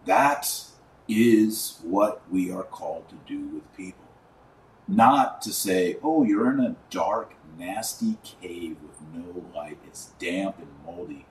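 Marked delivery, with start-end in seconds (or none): none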